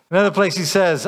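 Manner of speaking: ordinary delivery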